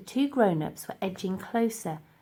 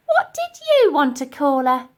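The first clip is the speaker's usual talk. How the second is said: higher, loud curious; Dotty; 7 semitones higher, 10 dB louder than usual. Acted. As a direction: ask kindly. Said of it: "asked Dotty".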